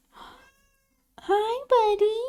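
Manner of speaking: very high, soft greeting to a cat; cooing at her cat; pet voice. Real, not acted. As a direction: coo at the cat.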